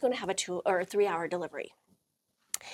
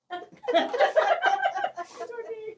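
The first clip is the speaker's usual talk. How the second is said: high mock hysterics; acted laughing fit. Couple laughing as the door opens, acted high laughter. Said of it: "then the door, and we're like".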